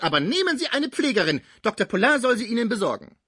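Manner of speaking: self-pitying grand exit; shrill wounded housekeeper. A narrator's reading. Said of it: "mit einem niederschmetternden Blick".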